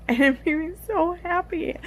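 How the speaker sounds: laughing through happy tears; a high, giddy laugh into the words; emotional laugh. Real, not acted. Vlogger overcome by a favourite book scene, laughing emotionally. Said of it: "I'm, like, getting emotional about it".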